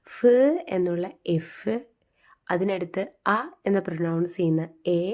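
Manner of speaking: ordinary talk, nothing marked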